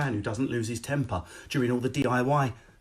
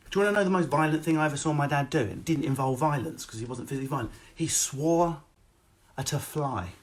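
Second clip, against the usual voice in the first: normal talking voice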